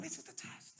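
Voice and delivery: whisper. Real, not acted. Hushed aside during the test.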